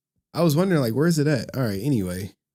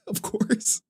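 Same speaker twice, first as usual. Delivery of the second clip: high, laughing concession; chuckled, pitched-up resignation; amused resignation; 6 semitones higher, 6 dB quieter than usual. Real, not acted. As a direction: laugh as you give in to the joke. Laughing at the trolls' prank.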